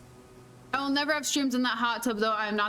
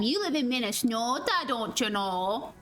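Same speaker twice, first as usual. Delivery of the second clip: mock Minnesotan accent. Teasing Eric about living in Minnesota, in a mock Minnesotan accent. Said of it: "without a Minnesotan accent".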